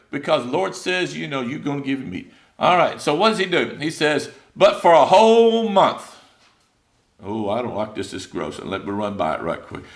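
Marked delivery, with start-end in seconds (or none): 4.5-6.2 "whole" stretched out slow, high and loud; drawn-out emphasis. Acted. Preacher quoting God's answer to complainers, drawn-out emphasis on "whole". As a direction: hammer "whole month" slowly at raised pitch.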